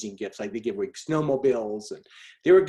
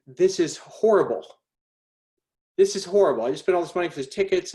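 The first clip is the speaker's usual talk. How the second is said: higher-pitched fretting over a blunder; retelling his own dismay, voice raised in pitch; 5 semitones higher, 3 dB louder than usual. Retold or imitated. Dad discovering his gift tickets are ruined, dismayed.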